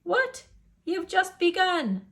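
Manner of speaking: high indignant what; surprised cook's cry. Acted. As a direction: protest that the fun stopped. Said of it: "she cried".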